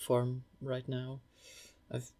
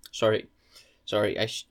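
mouth full; 3 semitones lower, 6 dB louder than usual. Real, not acted. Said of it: "shouldn't speak with my mouth full".

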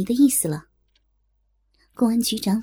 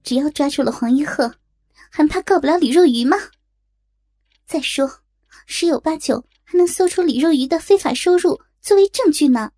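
higher-pitched, emphatic assurance; confident; 5 semitones higher, 4 dB louder than usual. A narrator's reading. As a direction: argue the case with full conviction. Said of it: "胸有成竹地说". (胸有成竹、语气坚定)

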